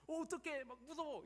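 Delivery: high-pitched mock panic; scared. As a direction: act out a frightened soldier. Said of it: "이런 게 아니라". (겁먹은 듯)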